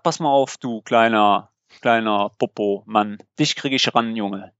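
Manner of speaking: low-pitched warning to a nuisance caller; retold threat. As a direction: threaten him in a low voice, retelling it. (tief nachgespielte Drohung)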